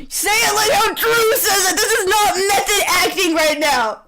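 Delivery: high, laughing, indignant scolding; faux-furious while cracking up; laughing mock outrage. Real, not acted. Scolding partner about line delivery, laughing outrage.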